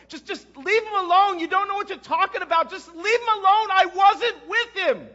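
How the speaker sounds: very high, very loud screamed denial; desperate outburst. Acted. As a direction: yell at the top of your voice, worn past breaking. Final outburst denying Jesus.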